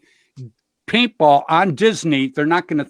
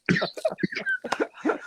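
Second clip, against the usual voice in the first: laughing; 9 dB quieter than usual. Real, not acted. Laughing after the bear story.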